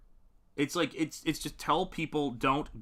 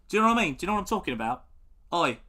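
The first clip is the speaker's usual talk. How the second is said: put-on English accent; 3 semitones higher, much faster than usual.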